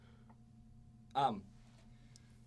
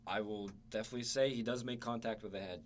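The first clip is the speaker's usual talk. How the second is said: ordinary talk, nothing marked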